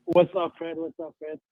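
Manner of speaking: faint voice, far from the phone; speaking away from the mic; phone held away. Real, not acted. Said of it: "You gotta speak into the phone".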